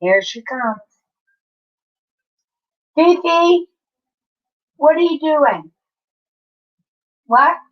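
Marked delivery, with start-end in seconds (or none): 3.0-7.6 much higher, louder call out the window; calling the cat. Real, not acted. Owner calling the stray cat at the window, high loud call.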